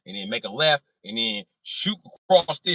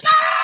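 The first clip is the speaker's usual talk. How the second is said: sharp high whoop of thrill; high yelp; 10 dB louder than usual. Retold or imitated. Driver whooping as the car bounces, high yelp.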